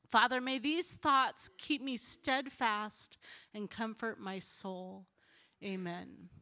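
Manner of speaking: slow prayer, softening toward the amen; measured, reverent prayer with long pauses; prayerful reading. Real, not acted. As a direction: read each petition slowly and let it fade. Continues reading the prayer after tearing up.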